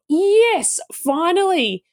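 high, triumphant yes at last; excited relief. Retold or imitated. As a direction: exult as if a long wish is granted. Thinks her wish is coming true.

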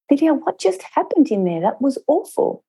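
high, stunned question to a colleague; shocked disbelief. Retold or imitated. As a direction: replay your own dismayed shock after the meeting. Shocked after a failed meeting.

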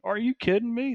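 high, squeaky are-you-kidding-me; Are you kidding me, sky high and teasing; mock disbelief. Coach to doubting client, high incredulous squeal.

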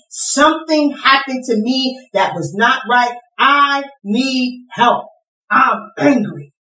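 high, pleading admission of hurt; impassioned angry confession. Acted. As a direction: cry out for help, acting out the anger. Voices a hurt person admitting anger.

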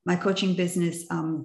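ordinary talk, nothing marked

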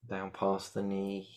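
ordinary talk, nothing marked

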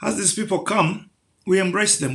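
normal talking voice

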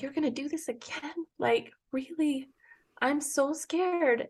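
high, soft, slowed-down plea, close to tears; small, high, tearful voice; tearful child retold. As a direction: imitate a scared son, choked up. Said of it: "He started crying".

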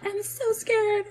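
very high, drawn-out mock kid wail; imitated scared child. Mother imitating her kids at night, scared. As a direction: squeal it slowly like a frightened little kid. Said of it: "when one of my kids is scared".

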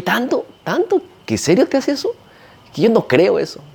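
high, disbelieving questions; incredulous. Retold or imitated. Imitating a doubtful client questioning the ceremony, incredulous. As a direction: scoff in mock disbelief. Skeptical client quoted in story.

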